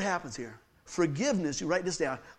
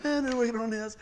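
mock busy mumbling; 2 semitones higher, 5 dB quieter, slower than usual. Coworkers suddenly look busy, avoiding him.

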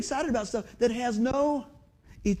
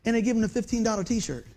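ordinary talk

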